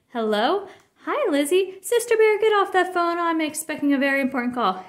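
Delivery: high, loud nagging mother; shrill it like a fussy mom; mock bossy Mama. Cub playing bossy Mama.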